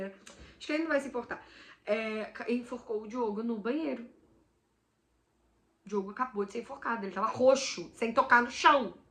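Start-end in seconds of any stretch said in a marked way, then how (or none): none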